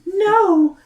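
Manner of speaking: put-on very high girlish Mina voice. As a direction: squeak it out like a young girl.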